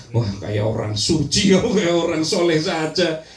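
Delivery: sneering neighbour teasing a new worshipper; mock gossip about a neighbour in a gamis. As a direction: poke fun at the gamis-wearer with a laugh. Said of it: "Nggak boleh gitu".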